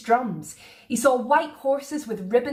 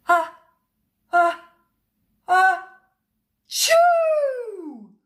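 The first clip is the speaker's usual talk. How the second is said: rising, louder ah-ah-ah into a big shoo; building sneeze; 8 semitones higher, 5 dB louder, much slower than usual. A narrator's reading. Sam sneezing at the Queen's coach, building up. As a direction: wind up a huge sneeze and let it burst.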